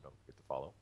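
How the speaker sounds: ordinary talk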